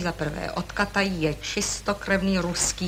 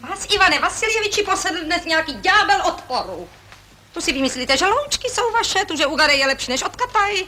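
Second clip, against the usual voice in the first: high, fast, exasperated accusation; shrill-free high indignant scolding; indignant outburst; 8 semitones higher than usual. Acted. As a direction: fling the charges at him, pitch up. Escalating quarrel over dogs.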